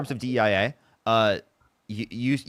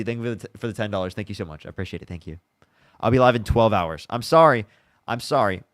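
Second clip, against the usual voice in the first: ordinary talk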